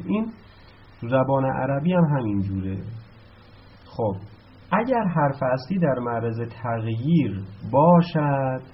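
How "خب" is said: ordinary delivery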